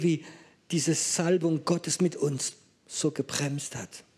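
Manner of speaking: normal talking voice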